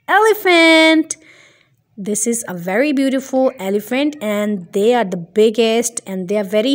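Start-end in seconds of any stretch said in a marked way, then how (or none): 0.1-1.2 higher, louder, a burst of joy; excited reveal. Shown as a demonstration. Teacher revealing the elephant to kids, excited. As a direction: announce it like a prize.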